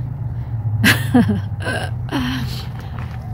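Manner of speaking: long trailing laugh. Real, not acted. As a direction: laugh it out until the breath goes. Laughs after her Titanic comparison. (cười một tràng dài)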